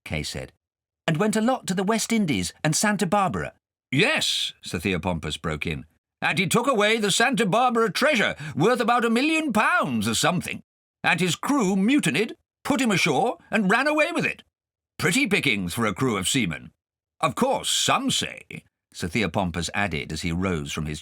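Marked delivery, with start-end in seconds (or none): 1.1-3.5 high, lightly voiced boy; young boy. Kay answering his guardian, a high boy's voice. 3.9-4.5 put-on high, loud blustering old gentleman; pompous guardian. Guardian quizzing Kay at dinner, pompous and loud. 6.2-18.6 put-on high, loud blustering old gentleman; pompous guardian. Guardian quizzing Kay at dinner, pompous and loud.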